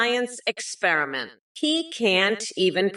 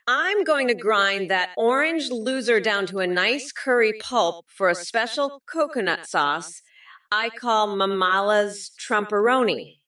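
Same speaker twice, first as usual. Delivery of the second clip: usual speaking voice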